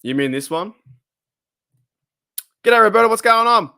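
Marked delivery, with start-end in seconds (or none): 2.6-3.7 big cheery hello, raised high and loud; hearty shout-out to a chatter; loud greeting. Real, not acted. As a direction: call out a happy hello to a viewer. Greeting a chat viewer loudly.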